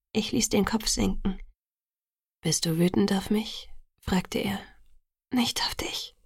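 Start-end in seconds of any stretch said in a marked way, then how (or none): 2.4-3.7 hushed question. A narrator's reading. Hushed question in tense exchange. 5.3-6.1 soft, breath-led whisper. A narrator's reading. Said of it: "flüsterte ich".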